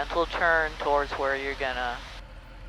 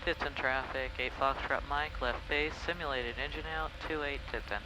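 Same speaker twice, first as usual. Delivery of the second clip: radio call; 9 dB quieter than usual. Acted.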